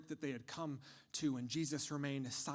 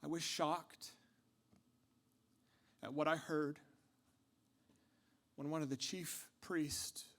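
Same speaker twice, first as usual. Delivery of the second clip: ordinary talk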